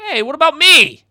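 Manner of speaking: loud, high protest; indignant call. Acted. Character left behind calling after the others, indignant shout.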